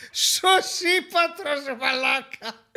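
high, very slow, drawn-out disbelief; stretched-out, high-pitched marvelling; incredulous amazement. Real, not acted. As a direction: savour the absurd name in astonishment. Incredulous at an absurd name.

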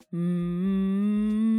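long steady chest hum; sustained "mm" felt in the chest; closed-mouth hum. Shown as a demonstration. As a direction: hold a hum with lips closed. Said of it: "Encore une fois".

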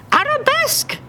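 very high shouted dance call; shouted command. Acted. Harry calling steps to skidding Dave, shouted high. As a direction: bark it like a strict ballet teacher. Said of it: "she'd shout".

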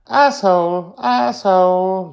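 put-on high, loud, drawn-out child voice; defiant young Joy. A narrator's reading. Girl repeats a forbidden word, high and defiant. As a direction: stretch it out loudly like a kid.

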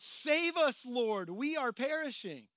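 high, loud cry to be saved; yelled plea. Retold or imitated. Disciple yelling to Jesus in the storm, loud plea. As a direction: shout to the Lord as the boat sinks. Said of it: "yells out".

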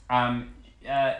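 normal talking voice

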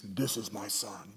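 low, slow voice from the clouds; hushed mock-booming divine voice. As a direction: imitate thunder, deep but held down in volume. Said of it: "booming voice".

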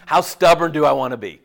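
emphatic repeat. Shown as a demonstration. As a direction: hit "stubborn" hard the second time.

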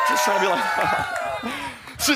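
a sudden high squealing shout of laughter. Real, not acted. Preacher cracking himself up with a high shout. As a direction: break into a high yelp of a laugh. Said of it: "I'm just trying to be like Christ".

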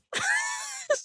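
a burst of high shrieking laughter. Real, not acted. Laughing at her own confession to the host, a shrieking laugh.

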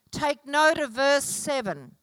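ordinary talk, nothing marked

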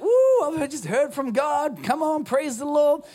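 high, loud, giddy praise shout; excited whoop. Retold or imitated. Preacher acting out Peter thrilled to hear from God, ecstatic shout. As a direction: gush like you just heard from God yourself.

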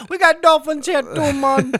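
put-on high laid-back Jamaican accent; loose, laughing Jamaican accent. Jamaican local answering tourists.

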